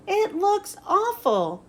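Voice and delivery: high, slow disgusted verdict; Sally frowning at a bad road; dismayed. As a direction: let the disgust stretch out.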